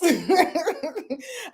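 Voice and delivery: real laugh, pitched well up; amused high laughter. Real, not acted. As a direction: laugh high after the joke.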